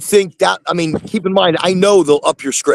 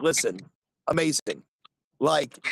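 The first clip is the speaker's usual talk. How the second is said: much softer, lower, secretive doctor; doctor hushing about what he bought, much softer; hushed confiding; 4 semitones lower, 12 dB quieter than usual. Retold or imitated. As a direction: lean in and confide it quietly, like a secret. Doctor confiding about Goldflower purchase.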